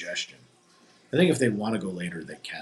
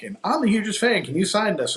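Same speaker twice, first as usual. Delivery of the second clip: eager fan gush; 10 semitones higher, 3 dB louder than usual. Retold or imitated. Fan asking for autographs.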